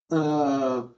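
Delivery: usual speaking voice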